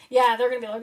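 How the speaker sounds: ordinary delivery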